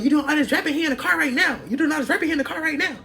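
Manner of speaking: high mocking singsong taunt; taunting coworker. Coworker taunting her about the guy in the car, high mocking voice. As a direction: needle her in a high teasing voice. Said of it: "Bonnie Girl was like".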